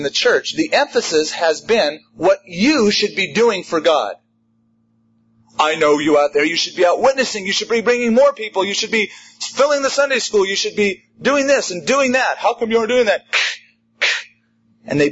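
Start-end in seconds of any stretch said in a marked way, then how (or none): 5.6-13.2 higher, nagging mock preacher; scolding preacher. Mocking preachers who beat the sheep, higher mock scolding. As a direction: parody a preacher hounding his flock.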